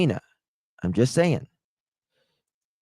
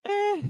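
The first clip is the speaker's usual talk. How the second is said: high laughing squeak; 4 dB quieter than usual. Real, not acted.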